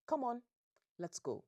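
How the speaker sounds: loud, high commanding bark; stern African dad ordering everyone out; African father voice. Acted. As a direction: order them out as a no-nonsense father. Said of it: "in African father voice".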